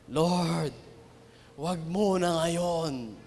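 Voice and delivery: pleading quietly for his dying dad; tearful prayer. Retold or imitated. Son praying in the hospital, soft tearful plea. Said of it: "and I'm crying".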